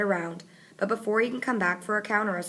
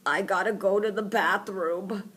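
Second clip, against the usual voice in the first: mumble. A narrator's reading. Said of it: "M B mumbles".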